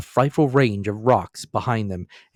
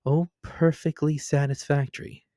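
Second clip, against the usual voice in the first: a touch of knowing mischief; lightly teasing aside; arch aside; 5 semitones higher than usual. A narrator's reading. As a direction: wink at the listener.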